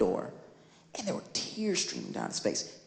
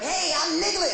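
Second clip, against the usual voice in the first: cartoon character voice; 10 semitones higher, 4 dB louder than usual. Imagined cartoon character greeting.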